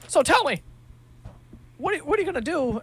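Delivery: hammed-up breathless newswoman; eager reporter. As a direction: fire the question off while running alongside. Reporter sprinting beside the kid.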